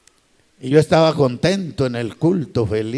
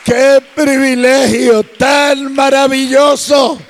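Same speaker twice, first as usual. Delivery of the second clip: ecstatic preaching; 8 semitones higher, 6 dB louder than usual. Acted. Pastor exalting speaking in tongues to congregation, high shouted praise. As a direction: build to a rapturous shout.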